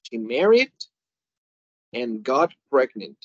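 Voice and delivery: married lifted, and got pregnant drawn out slowly; slow model sentence. Shown as a demonstration. Teacher reading an English example slowly. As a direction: read it slowly, word by word. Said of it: "si yo digo".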